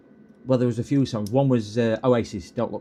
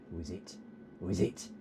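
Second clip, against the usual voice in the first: much softer, lower coaxing to the dog; coaxing whisper; 7 semitones lower, 14 dB quieter than usual. Real, not acted. Owner softly coaxing his dog to bark. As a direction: hush it to tempt the dog.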